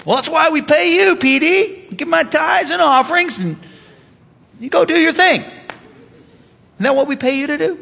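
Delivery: high-pitched mock objection; complaining church member. Church member telling the pastor to do his job, mock protest.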